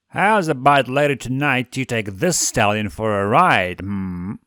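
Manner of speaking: loud, far-off seductive purr. A narrator's reading. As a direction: purr it suggestively. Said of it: "Blitz purred".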